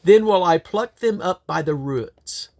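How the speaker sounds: ordinary talk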